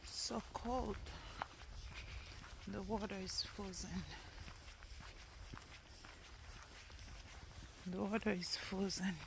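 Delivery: hushed under the breath; murmured to the camera; whispering. Real, not acted. Hushed remarks on frozen river.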